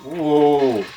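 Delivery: ordinary talk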